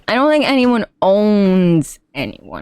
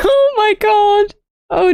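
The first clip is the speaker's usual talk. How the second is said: laughing; 4 dB louder than usual. Real, not acted. Laughing at something on screen.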